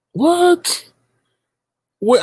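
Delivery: high, sharp shocked what. Real, not acted. Streamer reacting to a rude comment, shocked. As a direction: yelp it in disbelief.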